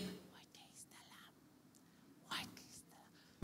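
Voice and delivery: whispered "Worthy is the Lamb" as the wrong way; breathy whisper, barely heard; mocking the congregation's weak answer in a whisper. Shown as a demonstration. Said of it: "Hindi yung".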